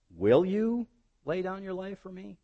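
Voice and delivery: "Will you" drawn up high, then a pause before the rest; stress on opening WILL YOU. Shown as a demonstration. Preacher asks as Jesus doubting Peter, stress on "Will you". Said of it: "where the emphasis is supposed to go".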